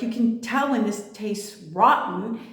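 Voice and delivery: ordinary talk, nothing marked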